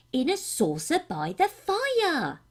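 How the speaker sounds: put-on lightly childlike, slow and proud; a boy giving the answer he's sure of; little page. A narrator's reading. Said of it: "the little page".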